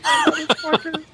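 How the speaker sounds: high, drawn-out exasperated curse. Retold or imitated. Finding no gas cap key, high exasperated curse. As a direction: stretch it out in disbelief at dad.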